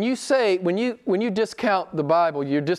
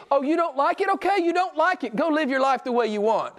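high-pitched exasperated dismissal; mock concession; 9 semitones higher than usual. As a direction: wave them away, voice pitched high.

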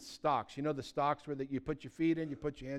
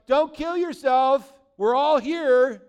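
slow, loud, higher call; yelling out; 9 semitones higher, 14 dB louder, much slower than usual. Retold or imitated. Paul calling out to the jailer, a slow loud yell. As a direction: shout it across the jail, drawn out. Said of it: "Paul yells out".

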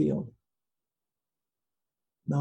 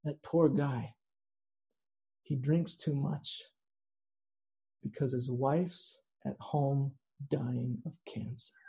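under his breath; 9 dB quieter than usual. Retold or imitated. Umpire leaning toward the catcher about a heckler, under his breath. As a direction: confide it softly, with long pauses. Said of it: "says under his breath".

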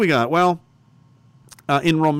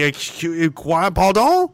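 mock confused French; 3 semitones higher than usual. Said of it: "I would say".

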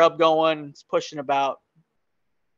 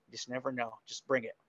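much softer, slightly lower voice; low-key reply; 4 semitones lower, 15 dB quieter, faster than usual. Retold or imitated. Telling his buddy what to bring, hushed and low-key. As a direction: drop it to an offhand low aside.

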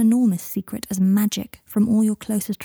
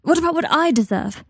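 put-on higher-pitched, mildly melodramatic child; upset child protesting; 8 semitones higher than usual. A narrator's reading.

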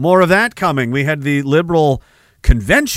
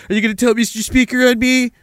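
higher, sing-song schoolyard taunt; mocking taunt; 7 semitones higher than usual. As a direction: tease like a bully. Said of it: "taunt them in public".

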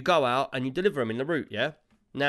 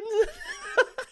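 squealing high laugh. Real, not acted. Laughing at his own impression, squealing high laugh.

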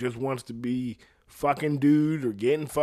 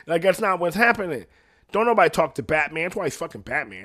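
normal talking voice

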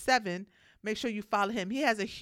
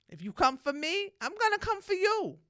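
defiant warning; 11 semitones higher than usual. Real, not acted. Warning challengers on her show.

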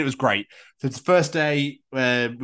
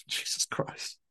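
breathy whispered laugh at herself; 11 dB quieter, slower than usual. Real, not acted. Laughing at her own tongue slip, whispered. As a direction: mutter Jesus Christ under a laugh, unvoiced.

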